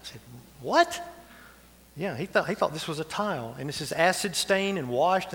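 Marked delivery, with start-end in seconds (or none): 0.6-1.0 surprised what. Retold or imitated. Pastor retelling his own reply.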